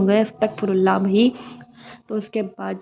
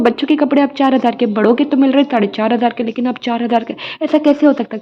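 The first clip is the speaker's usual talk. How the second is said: higher, louder outraged haggling; indignant price protest; 3 semitones higher, 7 dB louder than usual. Retold or imitated. To the shopkeeper about the dress price, raised indignant disbelief.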